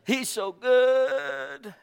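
high, slow, syrupy mock flattery; mock flatterers. Preacher mocking flatterers in a high, slow voice. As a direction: sweetly mimic the flatterers. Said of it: "people who flattered you".

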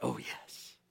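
breathy whisper of wonder; awed whisper. Real, not acted. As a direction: whisper it to yourself, overwhelmed. Awed aside after reading scripture.

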